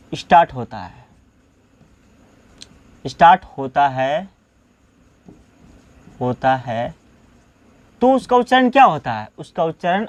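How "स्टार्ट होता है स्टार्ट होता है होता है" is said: low-pitched dictation, repeated with long pauses. Shown as a demonstration. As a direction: dictate piece by piece, leaving time to write. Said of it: "लिख लीजिए".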